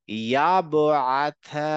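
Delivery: "ইয়াবআথা" very slow, ব bouncing before আ; qalqalah echo. Shown as a demonstration. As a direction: draw out "ইয়াবআথা" with an echo after ব. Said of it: "ইকো টাইপের sounds হচ্ছে".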